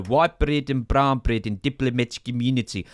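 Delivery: put-on lightly done South African accent; a hint of bad South African accent. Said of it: "the accent went somewhere between South African".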